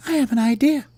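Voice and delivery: tiny, very high character voice; little worm voice. Acted. Worm pitches an idea to little bird, squeaky voice. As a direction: float the idea in falsetto.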